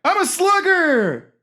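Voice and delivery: high, drawn-out mock battle cry; boastful shout. Player boasting amid silly banter, loud boastful shout. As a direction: holler it slowly and proudly.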